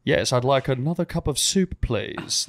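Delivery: light uppity British impression; a hint of a proper British accent; mock-posh British accent, fading at the end. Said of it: "proper British accent".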